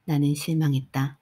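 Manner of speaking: usual speaking voice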